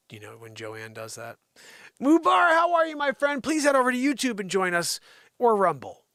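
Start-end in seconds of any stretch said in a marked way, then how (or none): 2.0-6.0 loud, high-pitched shout to a viewer; calling out greeting. Real, not acted. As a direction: hail a friend across the room. Hailing a viewer in live chat.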